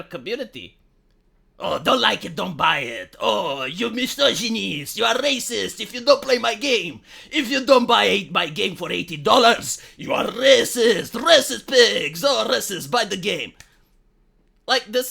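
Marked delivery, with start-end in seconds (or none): 1.6-13.5 mock game developer. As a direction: sneer like a smug developer scolding buyers. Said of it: "the behavior of game developers".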